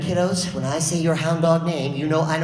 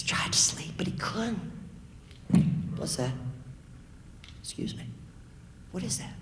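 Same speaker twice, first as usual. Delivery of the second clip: hushed spooky telling; 10 dB quieter than usual. A narrator's reading. Spooky night scene hush.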